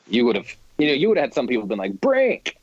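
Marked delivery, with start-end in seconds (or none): 2.0-2.6 high, thrilled cry of the title; excited shout. Retold or imitated. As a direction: shout it like a delighted fan.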